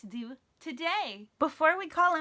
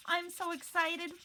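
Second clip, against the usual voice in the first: usual speaking voice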